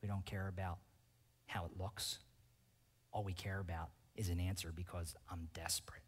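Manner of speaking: sinks into a whisper, very soft; intimate whisper. Real, not acted. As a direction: confiesa en un susurro. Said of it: "desperate prayer voice".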